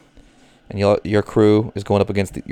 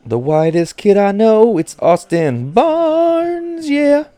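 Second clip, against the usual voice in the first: announcer voice; 13 semitones higher, much slower than usual. Pretend. Announcer-style hype intro of player.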